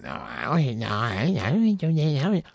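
high-pitched mock mom voice; exaggerated impression of mom denying it; imitating mom's denial. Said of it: "My mom's be like".

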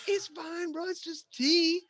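very high-pitched dismissive protest; mock reassurance. Other guy defends him to the cook, high mock reassurance. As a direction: squeal it like a pleading buddy.

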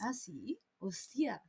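breathless hushed hostia; whispered surprise. Real, not acted. Caught out by her friend, a whispered oath.

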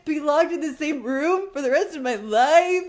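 hammed-up high, loud despair; mock meltdown. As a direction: wail the worst-case future, half laughing. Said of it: "we would be in shambles".

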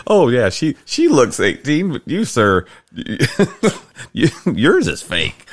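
high, laughing tease; laughing teasing. Real, not acted. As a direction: tease him through laughter. Son teasing dad, laughing.

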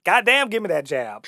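loud, high punchline yelp; hammed-up excited exclamation; mock eager outburst. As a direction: blurt it out, suddenly greedy for the money. Punchline quote of actress seeing check.

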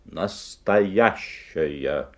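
Настоящая drawn out slowly, each syllable weighed; slow emphatic reading. Acted. Shopkeeper showing the boy the sign, Настоящая stretched slowly. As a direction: spell out Настоящая slowly with pride. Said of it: "Видите, что здесь написано?".

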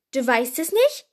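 loud, incredulous repeat; astonished, sharply rising question; dumbfounded question. A narrator's reading. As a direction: ask it loudly in utter disbelief. Louder repeat, Hagrid dumbfounded.

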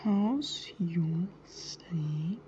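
slow, absent near-whisper; typing aloud. Real, not acted. Asking someone about studying by text, typing aloud. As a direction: whisper the words to yourself as you text.

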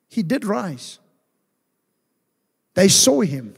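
ordinary talk, nothing marked

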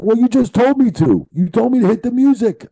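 high, loud defensive yelling; imitated indignant protest. Music man defends himself to the referee, indignant protest.

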